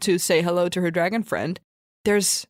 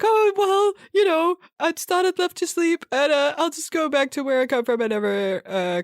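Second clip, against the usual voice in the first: put-on higher, nervous girl's voice backing away; lightly voiced girl edging away politely; nervous little girl; 9 semitones higher than usual. Nine-year-old girl excusing herself to the dragon, higher nervous voice.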